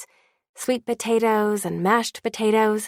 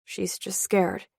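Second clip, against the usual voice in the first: gentle comfort; 5 dB quieter, slower than usual. Acted. Dad comforting crying daughter.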